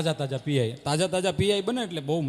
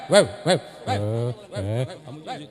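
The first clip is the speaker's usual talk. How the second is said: low drawn-out barking fading into a howl; mock dog howl; 8 semitones lower, slower than usual. Dog howling along to the singer, mock howl.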